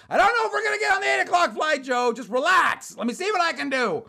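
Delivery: high, loud shout at a pest; exasperated shout. Retold or imitated. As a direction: shout him down, acting out lost patience. Yelling back at Joe.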